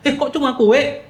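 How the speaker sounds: high, loud thoughtless question; blurted out. Acted. Hungry guest seeing only cake, blurting it out. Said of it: "kita bisa nyeplos dong kayak gitu".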